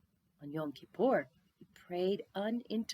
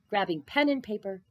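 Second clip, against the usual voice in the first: ordinary delivery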